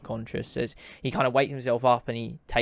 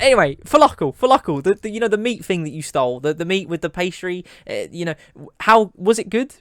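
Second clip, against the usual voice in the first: high, pushy, excited demand; eager pestering; 8 semitones higher, 7 dB louder than usual. Acted. Cook begging thief for a review, eager and high-pitched. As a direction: badger them excitedly for an opinion, voice jumping up.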